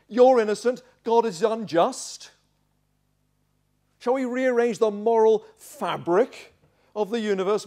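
loud, high incredulous questioning; indignant rhetorical questions, pushed high and loud; scornful challenge. Acted. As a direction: challenge him with mounting indignation. Bildad's scornful challenge to Job.

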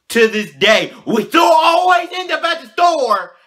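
loud, high, slow complaint; exasperated shout. Real, not acted. Vlogger ranting that every day ends at the store, loud and high. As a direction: belt out the frustration.

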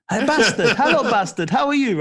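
loud, laughing mock Italian greeting; Italian accent impression. Former teammate imitating Paolo greeting him, loud mock Italian accent. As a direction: belt it out as the Italian maverick teasing a kid. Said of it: "I sound Welsh here".

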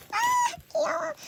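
sudden high squeal; squeal of delight. Real, not acted. Unboxing a cute figure for viewers, high squeal. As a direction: gush over it like it's adorable. Cooing over merch while unboxing.

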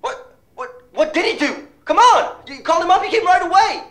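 exasperated, yelling at the friend; furious yell. Acted. Friend berating him, shouting.

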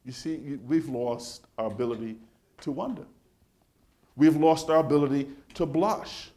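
ordinary talk, nothing marked

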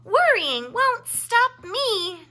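high, loud and slow cheer; proud little bear cheer. A narrator's reading. Little bear cheering as he drops his worry in the box. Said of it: "cheered Murray".

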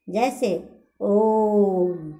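ओम with the ओ stretched to over three beats, very slow; drawn-out vowel. Shown as a demonstration. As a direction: pull the ओ in ओम out long before closing on म. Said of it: "ओ को लंबा खींचा जाता है".

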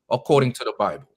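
ordinary talk

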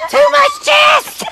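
high, slow yelp of protest; shout. Real, not acted. Bird bumps him too hard, high slow yelp.

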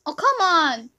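loud, high frustrated outburst; exasperated yell. Real, not acted. Player yelling at the game, loud and fed up. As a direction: shout at the screen in protest.

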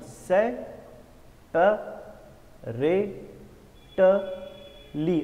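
"सेपरेटली" drawn apart into five slow, separate syllables; very slow syllable-by-syllable demo of "सेपरेटली"; broken into syllables. Shown as a demonstration. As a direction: sound out "सेपरेटली" with long gaps between the syllables. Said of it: "इसको मैंने कितने बार तोड़ दिया".

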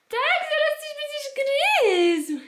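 very high sing-song to the dog. Real, not acted. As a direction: coo at the puppy. Cooing to the dog. (wysokie gruchanie do pieska)

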